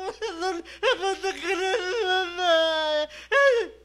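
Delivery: loud, high mock wail with garbled words; imitated wailing. Shown as a demonstration. Preacher showing believers crying to God beyond words, mock wailing. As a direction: act out sobbing too hard to form words. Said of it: "di ba hagulgol na lang".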